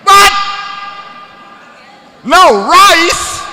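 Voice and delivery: high, loud mock outrage; shocked disbelief. Teacher mimicking students shocked at no rice. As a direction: gasp at the idea of no rice. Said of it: "looking at me like".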